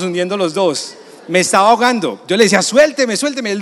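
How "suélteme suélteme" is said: high, loud cry of let go; a high, loud shout to be let go; retold panicked yell. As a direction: yell it as if going under. Drowning, friend grabbing him.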